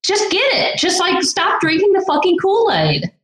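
high exasperated plea; pleading in frustration. Real, not acted. Exasperated rant.

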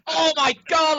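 very high, loud imitation of shock; mock shocked outburst. Sal learning he has been fooled, mock shocked outburst. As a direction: cry out in hammed-up disbelief. Sal reacting to prank reveal.

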